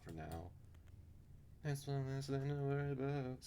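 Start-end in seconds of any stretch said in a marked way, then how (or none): 1.6-3.4 high, put-on voice; high character voice. Character relieved at one less worry, in a high put-on voice. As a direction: redo it lifted well above your own pitch.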